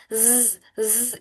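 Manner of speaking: ज़ drawn out slowly with a hiss of buzz; slow teacher's demo of the buzzing ज़; buzzing z sound. Shown as a demonstration. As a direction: stretch ज़ into a long buzzing z. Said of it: "जाल में सिटी की आवाज आती है".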